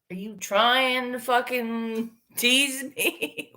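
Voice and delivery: ordinary talk, nothing marked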